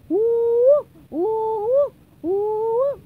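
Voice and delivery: loud, high siren whoops; vocal alarm whooping; alarm sounds. A narrator's reading. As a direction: wail like a siren, rising each time. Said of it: "still making alarm sounds".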